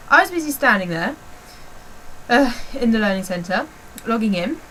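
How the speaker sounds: ordinary talk, nothing marked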